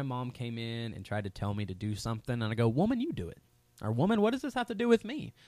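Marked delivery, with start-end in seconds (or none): 2.7-3.4 high, mocking disrespect; mock a son mouthing off to mom; sassy talkback. Son talking back to his mom, mock disrespectful. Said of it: "that's disrespectful". 3.9-5.3 high, louder mock sass; disrespectful talkback. Son mouthing off to his mother, mocking sass. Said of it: "that's disrespectful".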